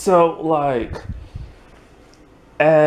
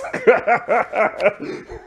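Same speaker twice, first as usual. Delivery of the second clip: high laugh; 8 semitones higher than usual. Real, not acted. Laughing at Esme's threat.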